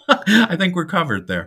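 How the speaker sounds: words spilling through laughter; laughing. Real, not acted. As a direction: chuckle through the line. Joking about shared birthday.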